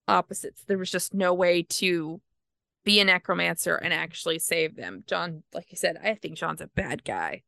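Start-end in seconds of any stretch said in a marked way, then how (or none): none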